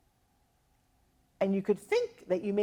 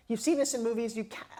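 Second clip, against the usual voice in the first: ordinary talk, nothing marked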